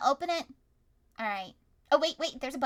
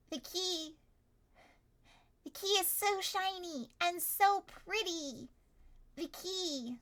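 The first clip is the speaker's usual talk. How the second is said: lightly voiced teasing fae, slow and higher; giggling fae voice; 6 semitones higher, slower than usual. Fae teasing the party in a higher, slower voice. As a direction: coo the words like a sly fairy. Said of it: "They're laughing and they're giggling".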